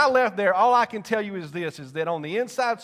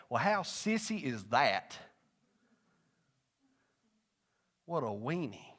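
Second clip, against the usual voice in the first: scornful muttering; 5 semitones lower, 8 dB quieter, slower than usual. Retold or imitated. His scornful younger self reacting.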